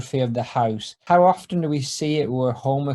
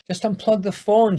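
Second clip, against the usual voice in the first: higher, curt and impatient; terse; 7 semitones higher than usual. Acted. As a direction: clip it short, irritated. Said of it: "somewhat tersely".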